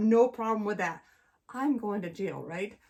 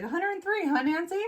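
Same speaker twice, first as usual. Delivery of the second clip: husband; 8 semitones higher than usual. Retold or imitated. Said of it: "He's like".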